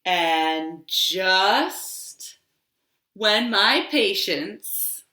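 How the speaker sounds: a tree telling its seasons, slow and expressive; tree character. Acted. Librarian reading a picture story to toddlers, storytelling voice. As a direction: read it as the tree, savouring each season.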